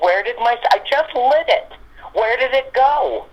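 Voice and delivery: high-pitched puzzled exclamation, retold; bewildered searching. Woman retelling her hunt for a just-lit cigarette, bewildered. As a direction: voice jumps up in baffled disbelief.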